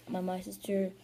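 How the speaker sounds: ordinary talk, nothing marked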